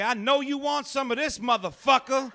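big woman hitting on a man; sassy woman come-on. Retold or imitated. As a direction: strut up and offer herself boldly. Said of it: "walk up to you and say".

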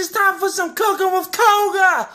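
high-pitched shouted TV-host intro; hyped show intro. Acted.

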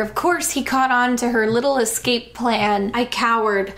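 ordinary delivery

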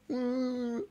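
high squeaky voice with mouth full; squirrel voice. Acted. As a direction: squeak it like a chipmunk with cheeks stuffed. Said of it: "just imagine she's a squirrel".

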